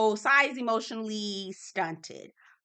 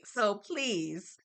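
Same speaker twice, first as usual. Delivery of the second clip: ordinary talk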